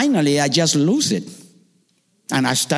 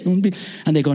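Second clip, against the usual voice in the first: usual speaking voice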